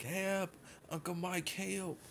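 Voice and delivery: slow, high, soft mock yell; retold cry for help. Boy calling Uncle Mike from the water, high mock yell. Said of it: "Anyway, I was like".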